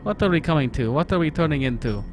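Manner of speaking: put-on game text read in a light Russian accent; mild Russian accent storytelling. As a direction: narrate as Ivan with a Russian accent. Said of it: "my Russian accent is Ivan's inner monologue".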